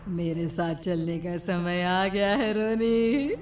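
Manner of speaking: slow, soft menacing witch; old hag. Acted. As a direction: whisper threats like a witch. Witch takes Roni.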